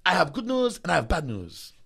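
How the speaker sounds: high, loud put-on voice for the trainer; imitating Fabian. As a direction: ham up the trainer's announcement, pitched high. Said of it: "So Fabian is like".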